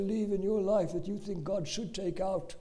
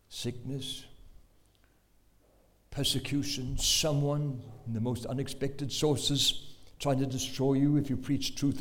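ordinary talk, nothing marked

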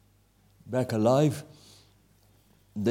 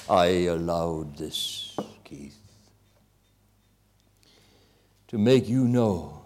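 low, slow, hushed divine voice; voice of God; 7 semitones lower, slower than usual. Retold or imitated. God answering the wounded preacher in his heart, low and hushed.

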